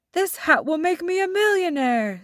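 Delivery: greedy magician gloating over the magic hat. A narrator's reading. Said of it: "said the greedy magician".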